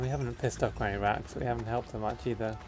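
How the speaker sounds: normal talking voice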